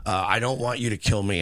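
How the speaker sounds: usual speaking voice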